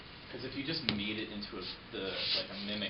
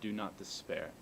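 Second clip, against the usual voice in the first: formal speech. Acted. Recites the speech.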